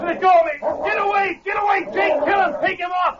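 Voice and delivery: high, frantic cries for help; frantic yelling. Acted. Gunman struggling with the dog, frantic yelling. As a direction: yell as if fighting off an attack.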